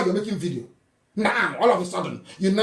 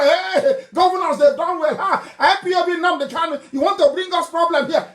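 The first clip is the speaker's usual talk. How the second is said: mocking the clappers; 8 semitones higher than usual. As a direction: parody the fools cheering the governors. Mocks clapping supporters of governors.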